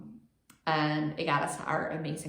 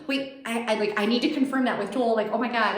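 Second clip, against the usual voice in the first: panicked realisation; 5 semitones higher, 5 dB louder than usual. Retold or imitated.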